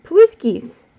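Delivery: "Paluskis" said as pa-LUS-kis, high and loud with a hard K; grandpa's pronunciation. Shown as a demonstration. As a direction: belt out "Paluskis" with a K sound, falling at the end. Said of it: "That's what my grandfather called it".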